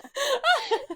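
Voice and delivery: very high, squealing laugh; shrieking laughter. Real, not acted. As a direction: keep laughing helplessly up high. Still cracking up at the memory.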